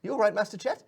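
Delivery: high, anxious little servant; concerned pixie. Acted. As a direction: fuss over your master. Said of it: "he's like".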